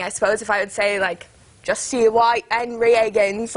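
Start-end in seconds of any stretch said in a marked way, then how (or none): 1.6-3.5 slow, drawn-out Cockney with dropped H's; gruff Cockney. Shown as a demonstration. Actress showing viewers Eliza's speech, gruff Cockney accent. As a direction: do a rough Cockney street seller. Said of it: "it's kind of more gruff sounding".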